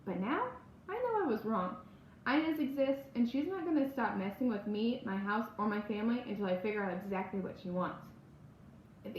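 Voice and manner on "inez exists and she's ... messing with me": ordinary delivery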